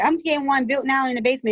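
usual speaking voice

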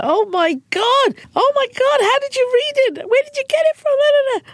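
very high, fast, breathless fan squeal; high-pitched starstruck squeal; freaked-out squeal. Retold or imitated. Retelling Safraz freaking out.

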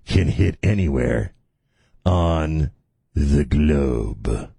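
low, slow and deliberate; ominously slow, with long pauses; slow spelling-out. Real, not acted. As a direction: drop your voice and spell it out phrase by phrase. Spells it out slowly for listeners.

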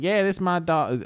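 proud Southern soldier. Acted. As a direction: show off the dog like a good old boy. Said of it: "the Americans were like".

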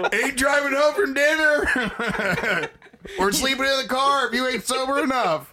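blurting it out while cracking up; laughing shout. Real, not acted. Laughing while joking about driving drunk.

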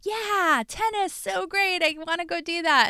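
high, breezy mock enthusiasm; a light hammed-up gush over tennis; naive enthusiasm. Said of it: "I'm like".

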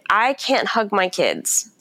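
ordinary delivery

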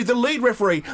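ordinary talk

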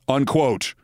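ordinary talk

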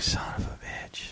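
hushed, stunned whispered curse. Retold or imitated. Hearing a former coworker is dying, a whispered curse. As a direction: breathe the curse out in shock. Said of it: "I'm like".

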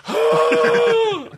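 very high showgirl squeal. Retold or imitated. Kid at shortstop after losing teeth, high showgirl squeal. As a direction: shriek like a showgirl with teeth knocked out. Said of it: "like a showgirl".

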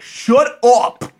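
high, loud frantic yell. Retold or imitated. Friend yelling back at the prank, loud and high. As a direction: yell it like you can't take it. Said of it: "he'd be like".

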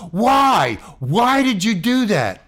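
loud, high demand for answers; reenacted indignant shout; retold yelling. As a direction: keep yelling, demanding a reason. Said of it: "I just kept it up".

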